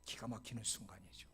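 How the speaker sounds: usual speaking voice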